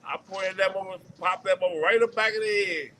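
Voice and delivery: normal talking voice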